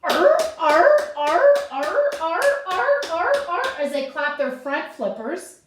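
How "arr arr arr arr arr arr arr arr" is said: happy, rhythmic seal barks. Shown as a demonstration. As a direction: bark like a cheerful seal. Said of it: "they sound something like this".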